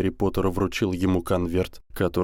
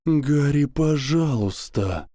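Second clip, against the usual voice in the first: high, slow pleading from a dying man; desperate dying plea; 7 semitones higher, slower than usual. A narrator's reading. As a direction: beg weakly with a failing voice. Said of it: "умирающий голос профессора Квиррелла".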